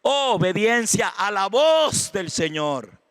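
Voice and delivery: loud, high, drawn-out proclamation; emphatic preaching. Acted. Pastor defining faith for the congregation, emphatic loud preaching. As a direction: proclaim the answer slowly and forcefully.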